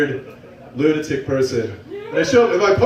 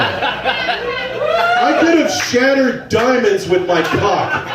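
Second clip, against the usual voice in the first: high drawn-out shout with a laugh; shouted boast; 7 semitones higher, much slower than usual. Real, not acted. Comic boasting to the crowd, slow laughing shout. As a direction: stretch it out and yell it laughing.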